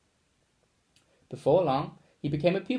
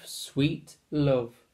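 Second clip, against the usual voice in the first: plain reading voice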